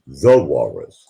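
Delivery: THE leaned on, then Walrus; stress on THE. Shown as a demonstration. Streamer insisting on the title, stressing THE. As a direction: hit THE hard in The Walrus. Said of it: "Not just Walrus".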